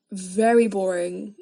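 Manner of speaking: ordinary talk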